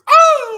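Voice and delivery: loud, high-pitched yell; imitated scream. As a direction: cry out high at full volume. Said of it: "all you heard was".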